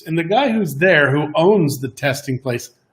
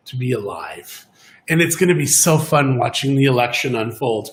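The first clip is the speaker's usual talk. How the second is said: ordinary delivery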